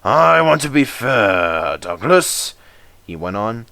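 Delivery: slow, hesitant boss trailing off; thoughtful controller. Acted. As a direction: weigh the matter aloud. Controller pondering.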